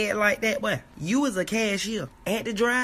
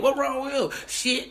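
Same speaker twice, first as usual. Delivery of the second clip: normal talking voice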